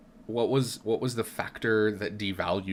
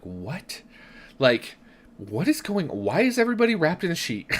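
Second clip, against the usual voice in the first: baffled; 8 semitones higher, 4 dB louder than usual. Retold or imitated. Retold baffled first reaction.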